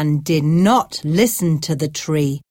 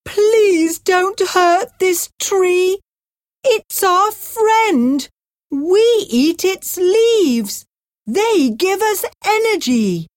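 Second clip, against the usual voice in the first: pleading giraffe; 11 semitones higher than usual. A narrator's reading. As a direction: beg the man to stop for the giraffe. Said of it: "The giraffe cried".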